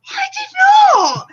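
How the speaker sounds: very high-pitched mock outrage; indignant protest. Re-enacting a denial of his wind-up, indignant protest. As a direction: squeal it in disbelief.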